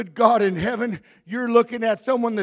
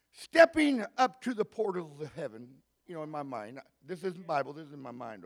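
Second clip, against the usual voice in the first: ordinary talk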